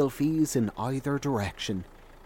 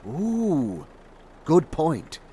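a higher, mocking impressed "ooh"; mock awe; 4 semitones higher, much slower than usual. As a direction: parody everyone being wowed by nothing. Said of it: "everyone's like".

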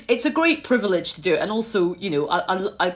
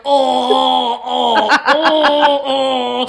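loud, drawn-out mock moans; imitated wailing groans; 2 semitones higher, 7 dB louder, much slower than usual. Imitating Ed battered in debate rehearsal, mock wailing. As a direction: wail "oh" like someone being crushed. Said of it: "And Ed was like".

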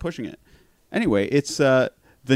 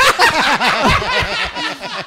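laughing hard; 6 dB louder than usual. Real, not acted. Laughing at wife's line.

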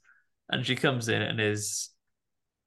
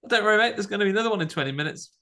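high, fast breezy railway conductor; cheery conductor; 9 semitones higher, much faster than usual. Retold or imitated. Conductor reassuring a student who missed the train, high and fast. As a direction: chirp it like a chipper conductor. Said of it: "the conductor says".

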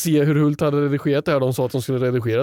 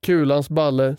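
normal talking voice

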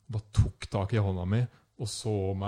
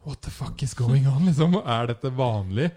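shocked question; 4 semitones higher, 8 dB louder than usual. Retold or imitated. Shocked tourist asks the boss.

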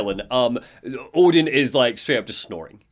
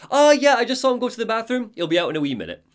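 lightly voiced helpful waitress, high and quick; chirpy Rose; 10 semitones higher, faster than usual. Rose telling Alan where Carl went, lightly chirpy. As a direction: start high and quick, then ease down. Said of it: "and Rose is like".

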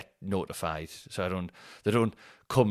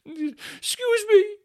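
squeaky, much higher slow wail, near crying; mock sad passenger; 10 dB louder, much slower than usual. Mock sob, passenger reclaiming his plane seat. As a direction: whimper it like a heartbroken child. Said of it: "he looked so sad".

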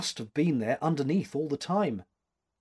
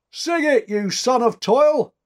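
high, very loud rowdy call; full-voiced call egging on a singer; shouted; 11 semitones higher, 11 dB louder, slower than usual. A narrator's reading. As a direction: shout it across the party. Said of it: "shouted somebody".

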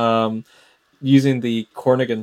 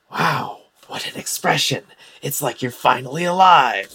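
higher-pitched, taunting villain admiration; [name] in character; 4 semitones higher than usual. Acted. [name] addresses worked-up Orem mid-battle, taunting in character. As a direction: goad your opponent with mock wonder. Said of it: "He's gonna say".

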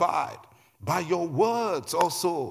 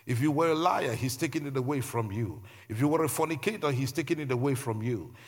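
usual speaking voice

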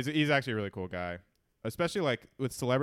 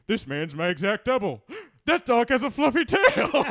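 jumping much higher and louder, slowing down; a dopey cartoon voice losing focus; distracted Homer; 8 semitones higher, 7 dB louder than usual. Homer at the bar seeing the dog, goofy high voice. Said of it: "He's like".

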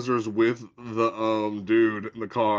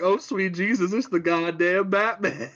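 higher, breathless swooning admirer; hammed-up swoon; mock swooning; 6 semitones higher than usual. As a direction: gush as if saved by a hero. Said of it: "she's like".

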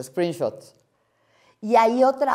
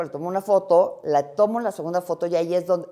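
normal talking voice

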